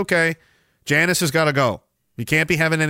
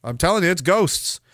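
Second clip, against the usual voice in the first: normal talking voice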